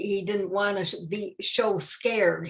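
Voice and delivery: plain reading voice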